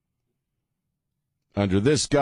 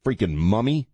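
normal talking voice

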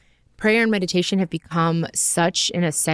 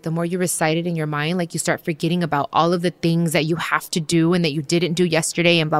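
ordinary talk

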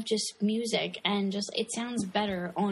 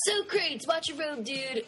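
movie quote; 7 semitones higher than usual. Retold or imitated.

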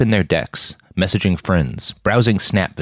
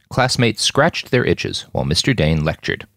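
usual speaking voice

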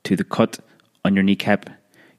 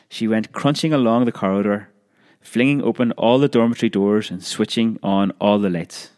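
ordinary delivery

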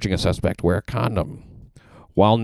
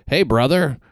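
higher, overfamiliar greeting; mock attempt at borrowed street talk; 7 semitones higher, 3 dB louder, slower than usual. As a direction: awkwardly try to sound chummy. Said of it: "speaking to someone of color".

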